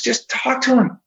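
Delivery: high-pitched exasperated plea; retold exasperation, pitch jumping up; exasperated urging. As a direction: urge him as if it's obvious. Son urging reluctant dad, retold.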